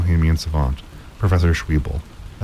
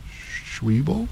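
soft, high, blank question; 10 semitones higher, 7 dB quieter than usual. A narrator's reading. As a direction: repeat the name without a clue. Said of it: "said Mina blankly".

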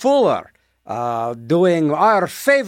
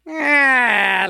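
ordinary talk

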